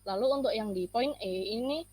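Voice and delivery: normal talking voice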